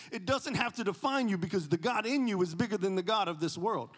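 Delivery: loud, high preaching cry; preacher thundering at the congregation; fiery preaching. Real, not acted. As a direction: preach it out at full force. Preaching at full force to congregation.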